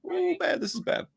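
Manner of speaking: very high squeaky aside; high-pitched dismay. Acted. Dismayed aside while reading the statement.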